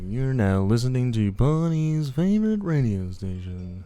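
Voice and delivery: slow, drawn-out jazz radio announcer; boring radio host. Retold or imitated. Co-host mimicking a jazz radio show host, slow radio voice. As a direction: stretch the words like a sleepy radio DJ. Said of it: "that really boring radio chat".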